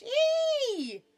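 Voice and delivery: very high, loud squeal; piercing, held yelp; pinched cry. A narrator's reading. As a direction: shriek a long note as if pinched hard. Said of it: "cried the bee again".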